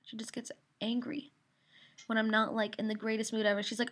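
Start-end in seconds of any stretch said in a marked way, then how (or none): none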